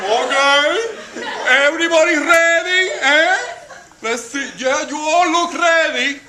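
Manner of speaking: put-on high Spanish-accented teacher running a contest; Mrs. Martinez the teacher.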